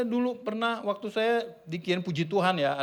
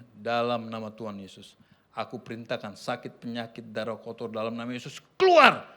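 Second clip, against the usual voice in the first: commanding healing prayer; 10 semitones lower than usual. Retold or imitated. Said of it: "Saya tempelin tangan saya ke perutnya".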